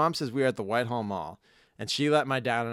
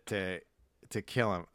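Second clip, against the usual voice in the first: normal talking voice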